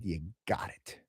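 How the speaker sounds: very soft, low retold approval; hushed approval. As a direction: drop right down to grant it quietly. Manager finally approves the answer.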